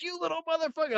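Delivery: squeaky retold insult; high mock snarl. Chasing guy cursing at him, high mock voice. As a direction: spit it out in a high put-on voice. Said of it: "he's like".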